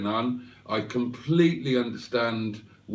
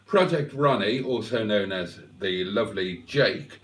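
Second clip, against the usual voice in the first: ordinary delivery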